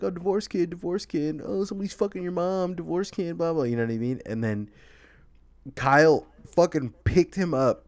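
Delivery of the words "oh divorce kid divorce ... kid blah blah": higher mock taunting kid voice; sing-song schoolyard taunt; mocking bully. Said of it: "kept calling him, like".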